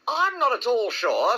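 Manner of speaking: high, slow musing; thoughtful. A narrator's reading. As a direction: ponder it slowly in a high voice. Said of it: "said Kanga in a thoughtful voice".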